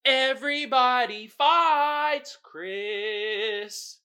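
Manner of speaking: slow, high drawn-out fights for the pun; slow punchline delivery; punny drawn-out. Shown as a demonstration. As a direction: stretch it to land the pun on Chris. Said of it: "See the double entendre that I did there".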